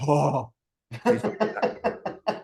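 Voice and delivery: high, slow plea through laughter; laughing plea. Real, not acted. Trainer reacting to agents' slash mistake, slow laughing plea. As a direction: beg them while cracking up.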